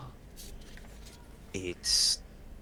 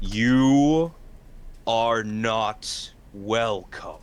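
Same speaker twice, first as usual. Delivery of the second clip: loud, slow commanding undead voice; deep rumbling voice; 3 semitones higher, 6 dB louder, much slower than usual. Acted. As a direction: boom it out with dread. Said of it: "deep and rumbling voice, one filled with authority".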